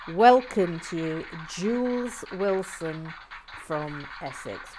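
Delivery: booming host welcome; grand welcome. Real, not acted. Grand welcome of new member.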